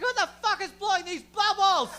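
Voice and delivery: high, loud mock yell from a furious driver; angry driver. Driver gets out of his car to yell at them, mock furious yell. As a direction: bellow like an outraged stranger. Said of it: "It's like".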